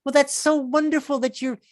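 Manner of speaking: high, slow gushing praise; people gushing. Retold or imitated. Fawning fans praising his charity in a high, slow gush. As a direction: coo it like a fawning admirer. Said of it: "They're like".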